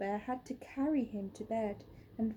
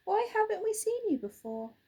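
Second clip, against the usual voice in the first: put-on much higher, curious child; a small bear full of wonder; little bear voice; 10 semitones higher, 4 dB louder, faster than usual. Little Bear asking Hoot, high curious voice.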